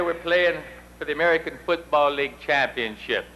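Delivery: ordinary talk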